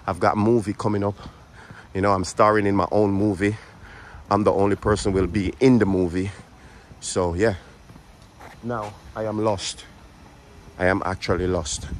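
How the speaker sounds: ordinary talk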